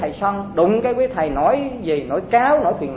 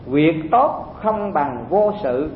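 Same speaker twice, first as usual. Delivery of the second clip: slow measured master's words; 4 semitones lower, much slower than usual. Retold or imitated. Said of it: "Thì Diệu Châu nói".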